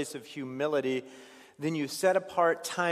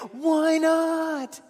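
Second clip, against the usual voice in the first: put-on high, loud whiny kid's retort; whiny child; 13 semitones higher, 6 dB louder than usual. Stubborn child whining back at God, high and loud. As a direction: whine it back like a stubborn kid.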